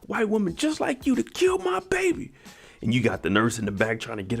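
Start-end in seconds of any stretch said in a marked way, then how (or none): 0.1-2.3 much higher, squealed accusation; Lucky snapping. Retold or imitated. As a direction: shriek it out, rising toward the end. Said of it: "make Lucky snap".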